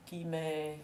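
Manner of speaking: usual speaking voice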